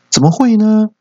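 high, sharp mock outrage; indignant disbelief. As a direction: snap back in disbelief as the mom. Stubborn mom denies it.

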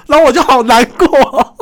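loud, high mock wail; hammed-up dismay. Son told by mom he hasn't lost weight, hammed-up wail. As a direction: wail it in comic self-pity. Hammed-up hurt at mom's answer.

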